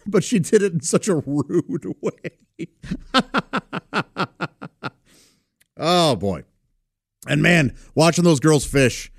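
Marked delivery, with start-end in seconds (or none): 2.7-4.9 soft laughter. Real, not acted. Amused by niece.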